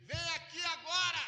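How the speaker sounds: high, loud yell; shouted summons. Retold or imitated. Neighbour shouting across the street, loud high yell. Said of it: "deu um grito".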